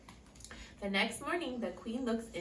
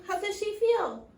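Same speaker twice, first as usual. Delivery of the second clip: ordinary delivery, nothing marked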